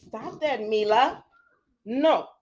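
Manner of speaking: usual speaking voice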